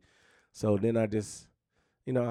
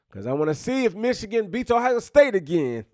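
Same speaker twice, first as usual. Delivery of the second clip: high, loud gloating dig at Ohio State; taunting rival fans; 11 semitones higher, 7 dB louder than usual. Real, not acted. Michigan fan needling Ohio State supporters, high loud taunt. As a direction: rub it in, grinning. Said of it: "That's why I wanna say it like that".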